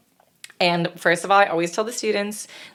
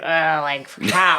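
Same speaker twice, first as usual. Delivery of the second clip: doubtful listener; 2 semitones lower, slower than usual. Acted. Player doubting advice about belief, mildly mock-sceptical. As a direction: imitate a sceptic hearing advice. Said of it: "people will hear that and be like".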